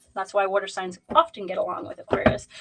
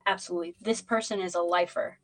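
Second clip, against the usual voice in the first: ordinary talk, nothing marked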